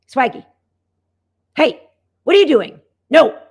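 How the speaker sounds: loud sharp scold, real-sounding; scolding demo. Shown as a demonstration. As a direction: scold the dog hard to show her reaction. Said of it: "I want you to see my dogs when I scold".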